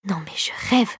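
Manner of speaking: low, half-whispered grumble; muttered cursing. A narrator's reading. Clara curses to herself, in a hushed grumble. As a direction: curse softly under your breath. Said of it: "pesta-t-elle".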